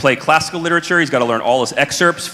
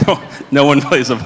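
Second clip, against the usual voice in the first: breaking up with laughter mid-sentence; real laughing. Real, not acted. Musician joking to the audience about the instrument, laughing. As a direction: crack up at your own joke while talking. Cracking up at own joke.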